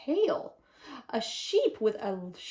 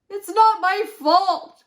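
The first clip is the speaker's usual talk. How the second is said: loud, higher, slow wailing protest; wolf crying out; 8 semitones higher, 11 dB louder, slower than usual. A narrator's reading. Wolf protesting to the sheep, in a loud wail. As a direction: wail it like a hurt child. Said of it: "cried the wolf".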